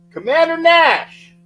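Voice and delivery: high, loud, slow call of the name. Real, not acted. Host hails a viewer by nickname, slow loud call. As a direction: stretch it out like an announcer. Greeting a guest on stream.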